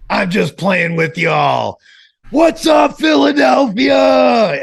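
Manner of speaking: high booming arena call, drawn out; wrestling promo shout. Retold or imitated. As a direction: win the crowd back at full voice.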